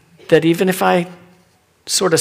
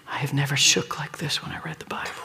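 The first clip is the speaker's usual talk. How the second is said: unvoiced whisper of shame; admitting shame to the congregation in a whisper; whispered confession; 10 dB quieter than usual. Real, not acted. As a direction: confess it under the breath.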